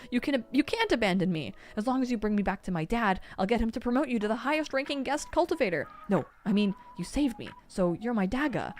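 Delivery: high frantic begging; desperate pleading. A narrator's reading. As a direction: beg him not to leave. Boy begs guard not to leave.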